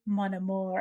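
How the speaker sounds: soft, quiet "Mon amour" in a French lilt; a soft, flirty French murmur. Retold or imitated. Nick flirts with Charlie in French, softly murmuring. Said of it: "And he's like".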